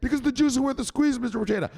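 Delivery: high-pitched, emphatic pledge; earnest put-on pupil voice; follower meaning it. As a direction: recite it like you mean it. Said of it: "Say it like you mean it".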